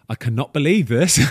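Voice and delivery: high, loud, buzzing with disbelief; excited retelling. Retold or imitated. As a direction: relay the news like you can't believe it. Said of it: "the call basically went along the lines of".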